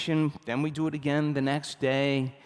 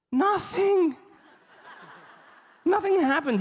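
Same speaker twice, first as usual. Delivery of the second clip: much higher, louder shout of the word; emphatic nothing; 4 dB louder than usual. Acted. Answering his own question to the audience, high loud emphatic shout. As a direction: belt the punchline at the crowd.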